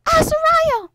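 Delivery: high, carrying call; shouting for him. Acted. Mother calling out for her lost son, high shout. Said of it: "And she shouted".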